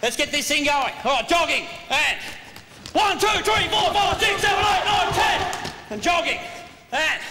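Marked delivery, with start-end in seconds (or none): 2.9-5.4 shouted count. Real, not acted. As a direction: bark the count like a coach. Coach counting warm-up, shouting.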